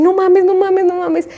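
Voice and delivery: high-pitched disbelief, holding back panic; disbelief, not hysterical. Retold or imitated. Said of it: "no me ponía histérica". (incredulidad aguda, sin llegar a la histeria)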